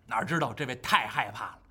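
ordinary delivery

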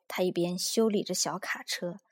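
ordinary delivery, nothing marked

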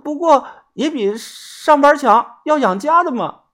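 put-on high, miserable young woman's voice; a caught swindler girl begging; pleading girl. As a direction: wheedle them to let you off. Girl explains earnings.

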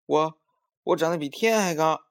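put-on high, loud childish one-upping; boastful clamour. A narrator's reading. Maomao jumping to outbrag Songsong, loud and high. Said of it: "妈妈听见他们直嚷嚷".

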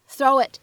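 "throw it" joined as "throw-wit"; linked with glide. Shown as a demonstration. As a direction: slip a w glide between "throw" and "it". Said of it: "此时我们可以读成".

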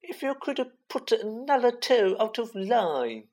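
very high mocking mother voice; shrill, piercing voice. A narrator's reading. Malfoy imitating Ron's mummy, shrill and piercing. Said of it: "He put on a shrill, piercing voice".

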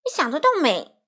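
impatient, indignant rebuff. A narrator's reading. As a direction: dismiss it, out of patience. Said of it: "花袜子不耐烦了".

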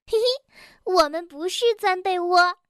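put-on high childlike voice with a chuckle; giggly little animal child. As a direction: chirp it like a cheeky kid. Narrator voices child Bengbeng. (高高的童声，俏皮地说)

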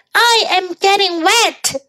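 high whiny complaint; gingerbread man voice. Acted. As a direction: whine in a little high voice.

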